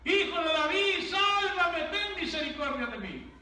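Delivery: loud, high begging cry; desperate shout. Acted. Blind man screaming to Jesus for salvation, loud desperate shout. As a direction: bellow for rescue with everything you have. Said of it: "Así el ciego decía".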